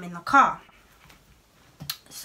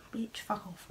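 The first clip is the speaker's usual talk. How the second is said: unvoiced, much softer whisper; inner whispered insult; 14 dB quieter than usual. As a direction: hiss the insult under your breath. Thinking insult at police.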